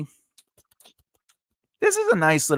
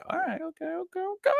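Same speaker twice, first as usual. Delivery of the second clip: high pet talk; 6 dB quieter, much faster than usual. Real, not acted.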